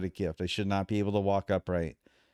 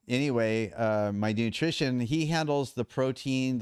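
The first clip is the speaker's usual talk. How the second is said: ordinary delivery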